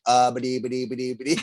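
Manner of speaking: comic flustered stuttering; imitating tongue-tied babble. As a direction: babble as if lost for words. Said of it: "You're like".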